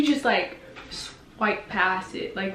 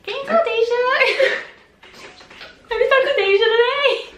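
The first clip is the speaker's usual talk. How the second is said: much higher, louder fan voice; mock subscribers; 5 dB louder than usual. Fans asking about Deja, high pestering. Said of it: "reminded every single day by your subscribers".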